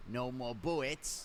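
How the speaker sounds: slow cartoon voice with softened consonants; slow, babyish mangling of the words; Elmer Fudd. Shown as a demonstration. Narrator impersonating it for the viewers, cartoon impression. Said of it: "I'm gonna impersonate it for you guys".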